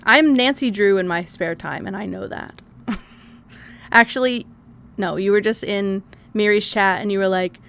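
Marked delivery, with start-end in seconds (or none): none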